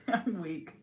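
ordinary talk

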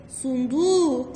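slow, stretched syllables for kindergarten; drawn out. Shown as a demonstration. Teacher stretching the word slowly for the kids. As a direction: draw the word out for the children. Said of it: "سورة ايه؟".

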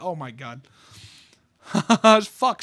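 normal talking voice

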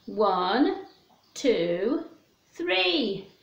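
plain reading voice

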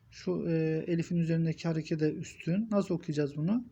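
ordinary talk, nothing marked

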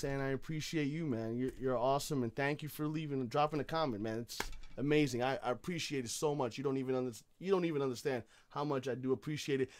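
ordinary talk, nothing marked

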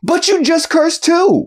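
very high-pitched, drawn-out comeback; indignant protest. Acted. Narrator accusing co-host of cursing too, indignant squeal. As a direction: squeal the accusation back in outrage. Mock argument over cursing.

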